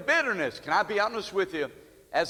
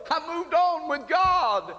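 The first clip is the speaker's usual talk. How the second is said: joyful testimony; 7 semitones higher than usual. Retold or imitated. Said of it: "She said".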